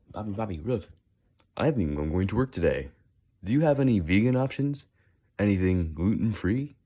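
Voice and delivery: swooping put-on meme voice; hammed-up, jumpy character quote. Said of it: "That's a good meme".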